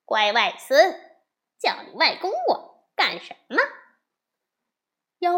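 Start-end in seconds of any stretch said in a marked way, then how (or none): 0.0-3.6 mocking laugh. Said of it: "悟空便笑着说".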